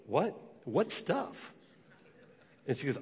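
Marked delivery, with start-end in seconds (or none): 0.1-1.5 soft, high-pitched incredulous questions; acted-out baffled reaction, voice lifting; mock bewilderment. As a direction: squeak it quietly in disbelief. Retells growing bafflement at her plan.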